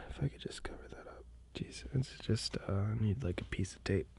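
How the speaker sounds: hushed whisper to himself while fiddling; whispered muttering. Real, not acted. Alone with the camera, muttering about tape in a whisper. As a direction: whisper it under your breath, mostly to yourself.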